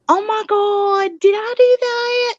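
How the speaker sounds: slow, high Southern belle drawl; Miss Po Panda. Acted. Pig as talking pothole, high slow Southern belle. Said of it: "That's Miss Po's voice".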